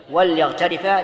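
"وليغترفا" answered as wal-yaghtarifa; vowelless lam. Shown as a demonstration. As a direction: settle on a closed l in "وليغترفا". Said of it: "لأن اللام لام".

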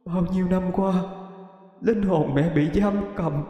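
high, aching mother's voice; tenderly moved, halting at first; tender motherly spirit. A narrator's reading. Ghost mother recognising her grown son, tenderly emotional. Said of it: "ánh mắt hiền hòa chứa chan của một người mẹ".

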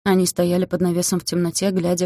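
ordinary delivery, nothing marked

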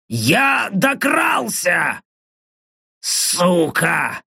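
slow, rasping orc voice. Acted. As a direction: wheeze it out like a dying orc. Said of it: "прохрипел голос орка". (хриплый голос орка)

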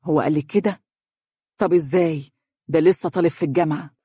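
pondering, puzzled questions with pauses; mildly doubtful musing; thoughtful. A narrator's reading. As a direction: think it over aloud. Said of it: "وقالت بتفكير".

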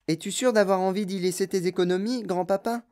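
usual speaking voice

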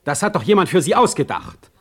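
loud, high, fast contempt; scornful outburst. Acted. Heine to Stege, mocking his provincial scheming, a scornful outburst. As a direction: tear into him with scorn.

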